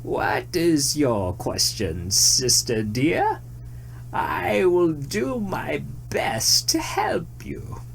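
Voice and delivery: very slow, whispered tree voice; old tree voice. A narrator's reading. Tree answering the deer, slow whispery tree voice. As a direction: breathe it out like rustling leaves. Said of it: "The tree waved its branches and replied".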